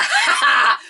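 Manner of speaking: high, loud gleeful taunting laugh; a triumphant in-your-face cackle; mocking laugh. Brains gloating at the doubting desk clerk, mocking laugh. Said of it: "and he's like".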